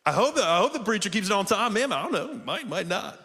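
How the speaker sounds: high-pitched, wavering doubt; doubtful hoping. Acted. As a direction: play a congregant who hopes but isn't sure. Acts out doubtful congregant hoping.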